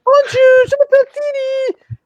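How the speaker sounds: very high, loud, squeaky French schoolboy; squeaky Thierry voice. Retold or imitated. Mocking a French language tape for a friend, in a very high squeaky voice. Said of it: "the really high pitched voice".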